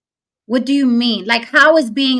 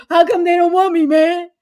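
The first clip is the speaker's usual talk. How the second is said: higher put-on uncle voice; 7 semitones higher than usual. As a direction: play the uncle from Fresh Prince, pleading. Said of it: "And the uncle".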